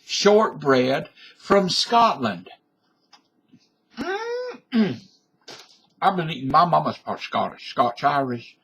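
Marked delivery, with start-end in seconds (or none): 4.0-5.0 savouring mm. Real, not acted. Savouring the cookie.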